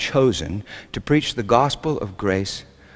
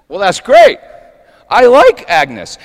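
loud, higher, acted-out enthusiasm; delighted approval; 8 semitones higher, 10 dB louder, slower than usual. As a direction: imitate a diner man jumping at an idea. Diner man welcomes party idea.

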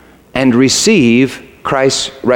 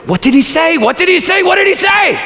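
pressing question rising higher each time; eager urging; faster than usual. Retold or imitated. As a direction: push her urgently for the answer. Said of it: "I remember I said to her".

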